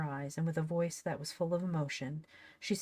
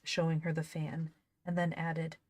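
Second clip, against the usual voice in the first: plain reading voice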